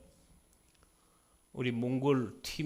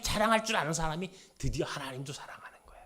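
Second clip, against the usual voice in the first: fast, hushed whisper; a sudden secret-like whisper; faster than usual. Acted. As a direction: lean in and whisper it. Intimate aside in sermon.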